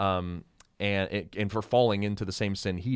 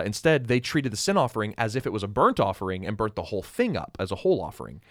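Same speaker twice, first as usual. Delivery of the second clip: usual speaking voice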